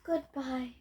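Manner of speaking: quiet. Acted. Piggy blown away, fading.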